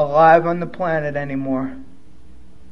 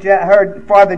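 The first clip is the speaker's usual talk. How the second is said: ordinary delivery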